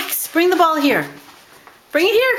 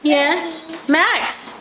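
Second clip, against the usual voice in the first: normal talking voice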